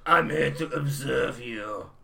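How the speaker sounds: slow, drawn-out impression of a failing actor; dying Rutger Hauer. Joking about an actor's game lines, slow and dying. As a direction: stretch the words as if giving a last performance. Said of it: "a dying Rutger Hauer giving his last performance".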